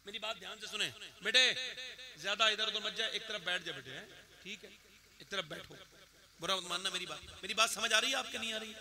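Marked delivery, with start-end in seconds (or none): 0.0-7.2 quiet aside. Real, not acted. Quietly settling a restless listener.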